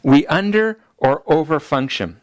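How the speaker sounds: ordinary delivery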